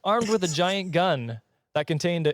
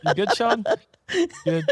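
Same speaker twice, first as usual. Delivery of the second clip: laughing; 9 semitones higher, 3 dB louder than usual. Real, not acted. Laughing too hard to speak.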